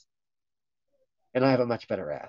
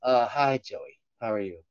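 ordinary delivery

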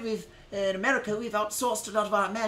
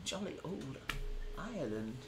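much softer, lower mumbled repeat; quietly second-guess the phrase; muttered repeat; 10 semitones lower, 15 dB quieter than usual. Shown as a demonstration. Streamer doubting his own Ireland phrase, muttered lower. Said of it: "That's not what they say".

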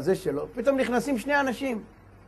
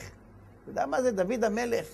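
usual speaking voice

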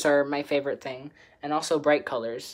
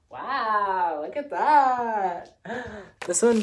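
delighted wow; 6 semitones higher, 4 dB louder, much slower than usual. Real, not acted. Admiring sweater on herself.

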